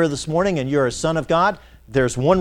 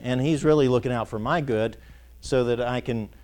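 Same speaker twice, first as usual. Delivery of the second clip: normal talking voice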